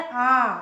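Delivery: आ said loud and long with the mouth wide open. Shown as a demonstration. Teacher shows students the open, long आ. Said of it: "पूरा मुंह खोल के".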